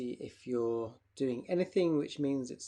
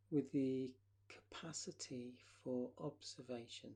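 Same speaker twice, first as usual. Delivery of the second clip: guided relaxation. Acted.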